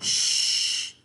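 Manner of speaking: whispered shh; hushing. Acted. Brother hushing his sister with a whisper. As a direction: hush her quickly.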